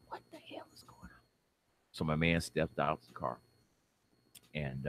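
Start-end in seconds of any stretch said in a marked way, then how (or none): 0.1-1.2 hushed, voiceless whisper; alarmed whisper. Acted. Man whispering to himself as a second police car pulls up, alarmed whisper. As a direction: whisper it fast under your breath.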